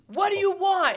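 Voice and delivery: high, loud movie yell; imitating him yelling at her in the rain. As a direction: shout it like the lover in the rain. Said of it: "And he's yelling at her".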